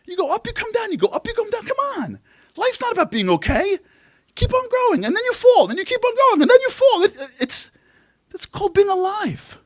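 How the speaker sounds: high, shouting rebuke; fired-up rabbi. Retold or imitated. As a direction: shout it like a rabbi shaking you awake. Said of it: "He goes".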